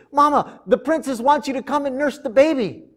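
put-on high, excited little girl bursting with news; can't wait to tell. Miriam reaches her mother, high and excited.

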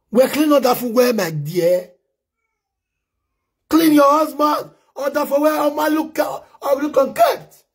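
high, slow scolding shout; mock telling-off of a wife.

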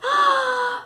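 loud high blowing puff; a big blow of breath; puffing blast. Shown as a demonstration. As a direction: puff with all your might.

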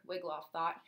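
ordinary delivery

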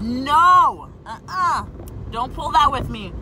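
don't pull that with me, snapped back at the coworker; sassy refusal. Retold or imitated. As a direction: shut her down with attitude. Retold confrontation with coworker.

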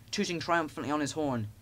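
usual speaking voice

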